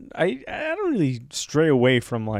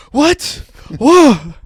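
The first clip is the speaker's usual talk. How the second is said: high, loud shocked reaction; surprised; 13 semitones higher, 12 dB louder than usual. Retold or imitated. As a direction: blurt it out in shock. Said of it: "people react".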